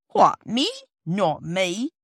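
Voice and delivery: loud, huffy porter denial; lofty. A narrator's reading. Porter brushing off the children, lofty and offended. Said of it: "said Perks loftily".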